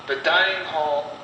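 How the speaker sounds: usual speaking voice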